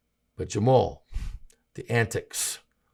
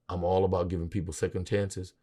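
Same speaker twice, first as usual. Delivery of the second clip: normal talking voice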